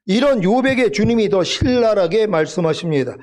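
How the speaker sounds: usual speaking voice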